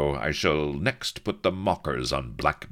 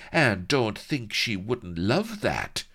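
higher, slow scornful jab; scoffing; 8 semitones higher, slower than usual. A narrator's reading. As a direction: sneer it out slowly. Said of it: "scoffed Vanity".

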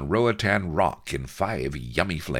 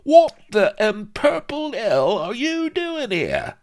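loud, very high squawk; squeaky sidekick's shocked outburst; Vladimir, aghast; 9 dB louder than usual. Acted. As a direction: squeal it in outraged astonishment.

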